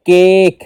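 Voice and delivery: کیک said with a long, open اے sound; long vowel demo. Shown as a demonstration. Teacher showing students the long اے in کیک. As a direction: stretch the اے in کیک. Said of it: "کھل کر آواز آ رہی ہے".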